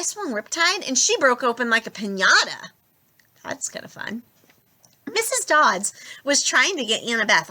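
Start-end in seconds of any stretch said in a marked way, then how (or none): 3.4-4.2 whispered under the breath; a soft, amused whisper; whispered aside. A narrator's reading. Percy whispers to himself mid-fight, amused.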